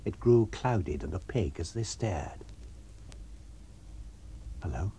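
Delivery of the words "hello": whispered startled hello; hushed surprise. A narrator's reading. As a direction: breathe it in quiet surprise. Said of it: "said the constable".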